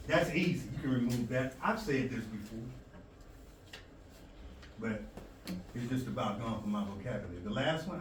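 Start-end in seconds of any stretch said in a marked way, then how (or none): none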